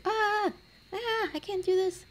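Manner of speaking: very high, strained panic; mock panicky squeal about never being alone, very high. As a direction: squeak like you are overwhelmed. Overwhelmed by roommates.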